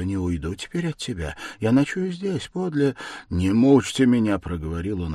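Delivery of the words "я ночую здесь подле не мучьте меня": loud, higher, irritated insistence. A narrator's reading. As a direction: snap in irritation, raising the voice. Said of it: "раздражительно махнув рукой".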